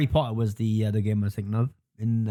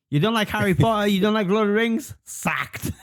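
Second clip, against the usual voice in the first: higher, incredulous questions, then a verdict; mock outrage; 7 semitones higher than usual. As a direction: act out a mildly outraged listener. Mock outraged listeners' tweet, acted out.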